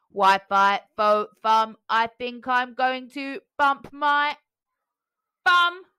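loud, slow robot chant; robotic giant voice. A narrator's reading. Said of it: "said the giant".

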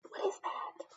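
What is that breathed with no voice; whisper. Real, not acted. Asking the child about the picture, whispering. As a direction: hush it like a secret.

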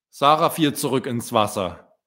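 plain reading voice

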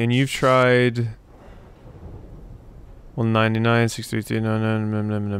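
normal talking voice

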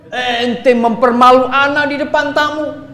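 high, loud mock scolding; indignant husband. Acting an angry offended husband. (suami tersinggung, pura-pura marah dengan suara tinggi)

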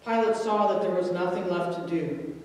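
usual speaking voice

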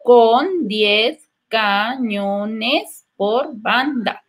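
Con diez cañones por banda said very slowly, syllable by syllable; separated slowly. Shown as a demonstration. Teacher separating the pirate verse for students. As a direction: drag each syllable apart. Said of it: "lo vamos a separar".

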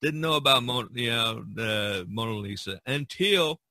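ordinary talk

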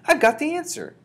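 high, loud excited cry; bursting with the discovery; shouted. A narrator's reading. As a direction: announce it at a high-pitched shout. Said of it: "I shouted".

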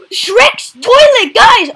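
slow, high, loud excited call; excited shout. Real, not acted. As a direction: belt it out, thrilled. Excited shout at game find.

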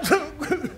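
high bursting laugh; hearty laugh. Acted. Kuma laughs at the lovesick young master, bursting laugh. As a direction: laugh out again.

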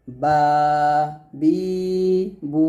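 বা, বি, বু each with its vowel held for one count; one-count vowel lengthening. Shown as a demonstration. Teacher gives madd examples, বা, বি, বু each drawn out. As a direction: stretch the open vowels of বা, বি, বু evenly. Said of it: "এভাবে আমরা মদের হরফ".

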